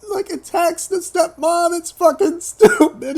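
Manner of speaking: high, squeaky voice cracking up; real laughing fit through the words; helpless laughter. Real, not acted. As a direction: squeeze the words out while losing it laughing. Breaking up over the stupid plot.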